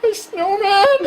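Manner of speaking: high, mock-tearful wail; wailing child. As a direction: bawl it like a bereft kid. Son's grief over snowman, acted.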